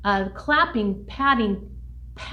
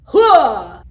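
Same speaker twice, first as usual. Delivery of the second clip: loud, punched "huh"; chant-ending "huh"; 12 dB louder than usual. Shown as a demonstration. Teacher pointing out the chant's ending, loud shouted "huh". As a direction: demonstrate the explosive closing "huh". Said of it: "at the end there is a huh".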